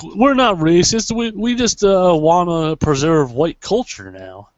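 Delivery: high, drawn-out put-on protest; mocking quote. Friend imitating the KKK's image makeover, mocking.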